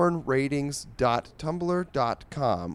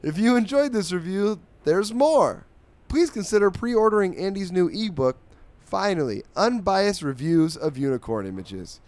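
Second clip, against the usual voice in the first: higher-pitched, energetic promo voice; upbeat sponsor read; 8 semitones higher, 5 dB louder than usual. Acted. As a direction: pitch the new product to listeners. Reads the ebook advertisement.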